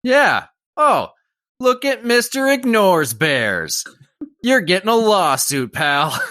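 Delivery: mock angry tourist. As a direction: jeer at the ranger like an entitled tourist. Acting angry tourist threatening lawsuit.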